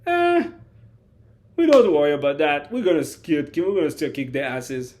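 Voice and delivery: put-on loud, cocky teen shrugging it off; brash dismissal. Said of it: "Lamine Yamal was like".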